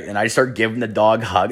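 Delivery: ordinary talk, nothing marked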